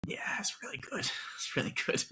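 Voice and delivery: near-whispered, very soft; whispery aside with a little laugh; hushed agreement. Real, not acted. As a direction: drop to a breath of a voice. Hushed agreement about a favorite film.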